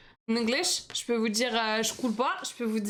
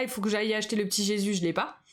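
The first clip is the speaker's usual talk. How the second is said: ordinary talk, nothing marked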